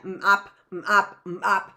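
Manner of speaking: quick repeated sound chunks; teacher speeding up the sounds; said really fast. Shown as a demonstration. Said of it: "I could say it really fast".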